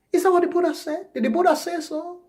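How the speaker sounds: high-pitched gentle query; nice correction. Acted. Coaching listeners to correct people nicely, sweetly high questioning. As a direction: ask it nicely, voice lifted high. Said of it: "You nicely kind of correct it".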